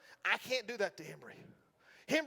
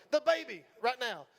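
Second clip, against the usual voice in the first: ordinary talk, nothing marked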